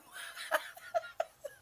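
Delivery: high, breathy laugh. Real, not acted. Coach at hip replacement joke, high breathy laugh. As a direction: let out a helpless wheezy chuckle.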